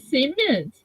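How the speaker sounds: cement as SEE-ment, "see" stressed; insisted pronunciation. Shown as a demonstration. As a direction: confirm your way: cement with a long first syllable. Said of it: "That's right".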